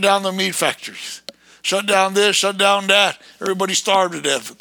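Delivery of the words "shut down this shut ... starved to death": usual speaking voice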